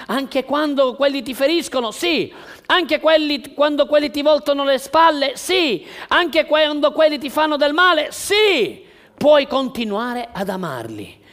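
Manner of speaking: loud, high, fervent preaching; preaching at full force. Acted. Pastor exhorting the church on love, preaching at full force. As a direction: drive it home to the congregation.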